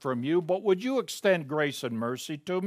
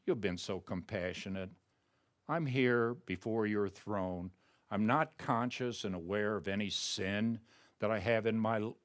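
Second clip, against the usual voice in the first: soft, softer, intimate murmur to God; hushed prayer; 5 semitones lower, 6 dB quieter than usual. Shown as a demonstration. Preacher modelling his own private prayer, softly. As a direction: pray it quietly, as if alone before the throne.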